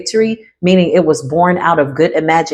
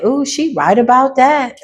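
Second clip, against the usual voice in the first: mock agreement; 6 semitones higher than usual. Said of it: "they saying".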